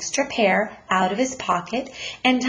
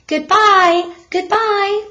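much higher and louder farewell; cry out; 12 semitones higher, 7 dB louder, slower than usual. Acted. Parents call goodbye to the mailed son, high and loud. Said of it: "cried out".

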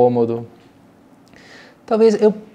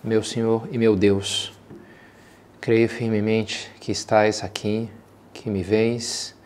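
quiet prayer; 4 dB quieter than usual. Acted. Opening prayer before meditation.

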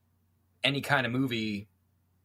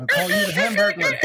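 loud, high barking drill instructor; drill sergeant shout; 8 dB louder than usual. Acted. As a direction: bark it at the recruit. Drill sergeant clown bit.

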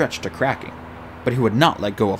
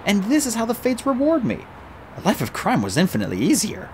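higher, wry complaint; exasperated; 10 semitones higher than usual. Acted. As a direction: sigh at the fates. Artemis grumbling.

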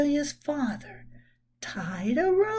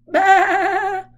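higher, loud bleating sheep; 5 semitones higher, 9 dB louder than usual. Acted. The sheep voting for pizza with a loud bleat. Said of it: "said the sheep".

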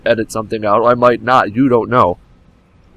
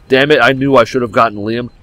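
normal talking voice

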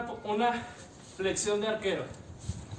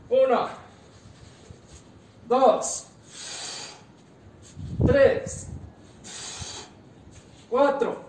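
shouted rep count, much louder and higher; barking gym coach; 4 semitones higher, 7 dB louder, much slower than usual. Acted. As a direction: push them through the last reps, yelling. Coach counting during hard set.

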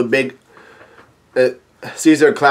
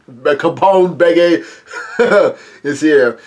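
laughing; much slower than usual. Real, not acted. Laughs while naming character.

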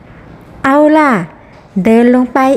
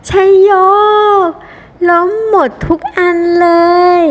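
cheering; 5 semitones higher than usual. Acted. Bowler cheers knocking every pin down, excited. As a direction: celebrate in a higher, playful voice.

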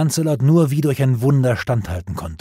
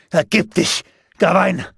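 higher, louder battle shout; 8 semitones higher, 5 dB louder than usual. A narrator's reading. Lancelot shouting at the kneeling Gawain to surrender. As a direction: bark the demand for surrender at the beaten foe. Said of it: "schrie Lancelot".

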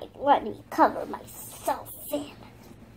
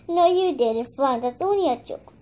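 ordinary talk

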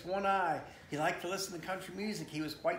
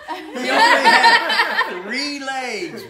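slow, much higher and louder in laughter; laughing; 9 semitones higher, 16 dB louder, much slower than usual. Real, not acted. Dad reading the book, cracking up. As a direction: drag the words out through laughter.

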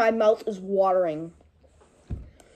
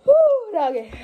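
excited; 10 semitones higher than usual. Real, not acted. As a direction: holler from the burn. Reacting to spice.

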